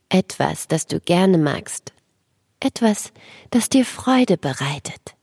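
sleep story narrator. Acted. Guided relaxation for children.

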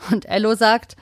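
usual speaking voice

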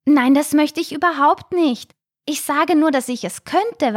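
high, earnest denial; protesting little shark. A narrator's reading. Little shark reassures the frightened snail, protesting.

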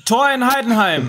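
high, loud goal announcement; excited goal call. Real, not acted. (aufgeregter Torruf)

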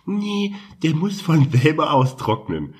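high, slow know-it-all voice; drawn-out mocking tone. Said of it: "die sagen".

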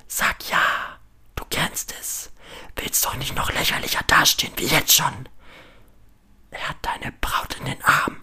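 urgent, goading whisper; shrilling inner voice. A narrator's reading. Inner voice urging Lukas to lie, intense whisper. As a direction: whisper harshly like a nagging voice in his head. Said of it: "schrillt die innere Stimme".

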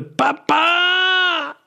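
sobbing scream, pitched very high; crying shout. Acted. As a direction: cry out for your father, breaking into tears. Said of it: "um ihn, brülle". (schluchzend aufschreien)

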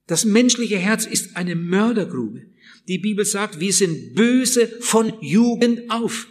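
usual speaking voice

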